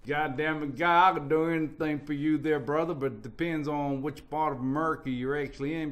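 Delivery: put-on loud, brash American accent; good ol' American bravado. Answering the host in an American accent. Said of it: "Can you do American accents?".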